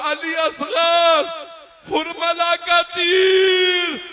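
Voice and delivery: very slow, high, loud wail; wailing lament cry. Acted. Maula whispering to the baby, cried out as loud slow wail. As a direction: cry each word out and stretch it. Imagined words of Maula, climax.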